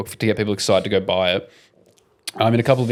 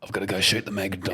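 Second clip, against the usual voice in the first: fast, softer breathy whisper in a British voice; hurried whisper aside; 7 dB quieter, faster than usual. Acted. Whispered Statham bit.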